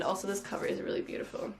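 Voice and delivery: quick whispered aside; fast breathy whisper. Real, not acted. Quick aside about the cover.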